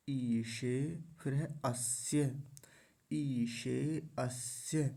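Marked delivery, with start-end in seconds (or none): 0.1-1.0 'ईशे' as ee-she; long ई, palatal श. Shown as a demonstration. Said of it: "तालव्य शकार". 3.1-4.8 'ईशे अस्य' slowly, long ee and clear sh; careful recitation. Shown as a demonstration. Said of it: "दोनों को मिला लेंगे".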